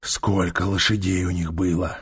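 low growled question; growling. A narrator's reading. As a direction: growl it at the prisoner. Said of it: "прорычал Трок".

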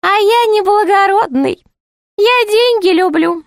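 very high, sing-song jester voice; put-on high jester voice; high-voiced jester. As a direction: play the court jester in a high, piping pitch.

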